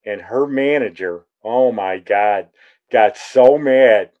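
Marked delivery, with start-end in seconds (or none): none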